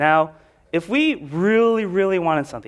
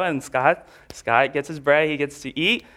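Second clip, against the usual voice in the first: ordinary delivery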